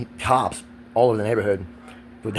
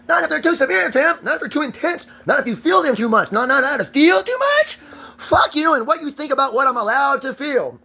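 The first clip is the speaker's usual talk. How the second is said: high, strained angry yelling, voice pitched way up; upset yelling; 11 semitones higher than usual. Real, not acted. Ranting man yells at his partner while filming, high and angry. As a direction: let the hurt burst out as high-pitched shouting.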